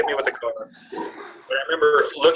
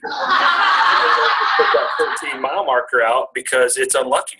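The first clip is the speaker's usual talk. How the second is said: laughing while talking; slower than usual. Real, not acted. Laughing at own hotel logic.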